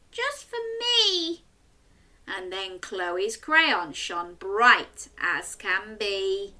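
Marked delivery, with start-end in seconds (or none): 0.1-1.4 sighing witch. Acted. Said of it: "sighed the witch".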